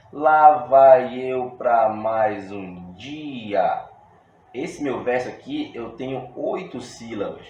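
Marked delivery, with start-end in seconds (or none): 0.1-3.9 each syllable spaced out very slowly and louder; scanning the verse syllable by syllable for the students; slow syllable count. Shown as a demonstration. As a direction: count out "Lá vai eu pra mais um dia" one syllable at a time. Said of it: "Vamos conferir aqui".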